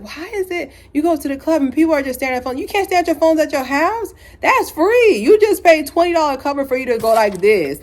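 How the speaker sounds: high-pitched, incredulous scolding; incredulous rant. Retold or imitated. Scolding people staring at phones in the club, incredulous.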